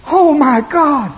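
high, loud, drawn-out amazement; acted awe, stretched out; astonished onlooker. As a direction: exclaim slowly as a stunned crowd. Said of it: "'Cause these people are going".

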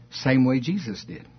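normal talking voice

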